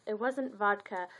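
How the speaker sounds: usual speaking voice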